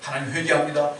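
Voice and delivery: low, subdued penitent murmur; acted repentance. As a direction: accept the blame quietly, as if praying. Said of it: "회개하고 넘어가는 거예요".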